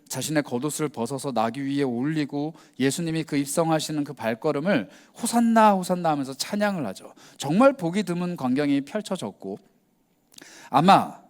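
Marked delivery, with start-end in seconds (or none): 5.2-6.1 a lifted, joyful hosanna; joyful hosanna cry. Retold or imitated. Crowd welcoming Jesus into Jerusalem, mild joyful cry. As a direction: cheer it like the crowd at the gate.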